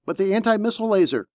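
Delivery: high, rushed protest; urgent objection. A narrator's reading. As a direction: question the order in a hurry. Gunner objects to order in battle.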